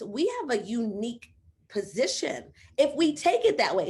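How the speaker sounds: ordinary talk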